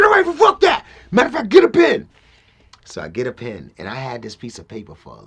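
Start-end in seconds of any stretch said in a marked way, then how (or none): 0.0-2.1 very high, imitated shout; fired-up agreement. Rapper satisfied with friend's answer, imitated fired-up shout. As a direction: yell it as an eager confirmation.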